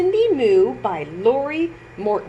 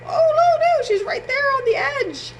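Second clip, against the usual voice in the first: high, louder alarm, quickening; 3 dB louder, faster than usual. Real, not acted. Teacher alarmed as Cindy nears the edge. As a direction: gasp at the danger.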